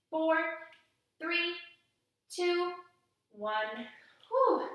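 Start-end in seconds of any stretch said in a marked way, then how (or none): 4.3-4.7 high, loud relieved whew; playful tired exhale after holding a pose; mock whew. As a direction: blow out like it was hard work.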